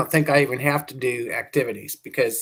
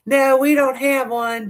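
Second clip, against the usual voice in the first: sweet, higher Southern lady voice, a bit slow; Southern drawl; 9 semitones higher than usual. Retold or imitated. Retelling the chamber of commerce lady's reply, sweet Southern drawl. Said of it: "she had that Southern drawl".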